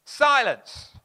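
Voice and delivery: loud, firm one-word command; commanding shout. Retold or imitated. Jesus commanding the wind, loud stern command. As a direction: silence the storm with force. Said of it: "He shouts at it".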